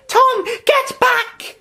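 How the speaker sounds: high, loud, slow frantic call; a frightened mother calls out her son; mother's cry. Acted. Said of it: "his mother cried".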